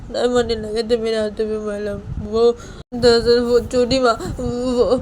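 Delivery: put-on sobbing, pleading and halting; crying. Bani tells chhoti maa who put ointment on, crying. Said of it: "तो बानी वो सभी बैठ के रो रही थी".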